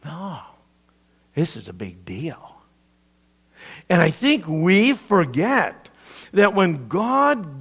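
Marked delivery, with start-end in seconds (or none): 0.0-2.6 whisper. Real, not acted. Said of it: "whispered emphasis in sermon".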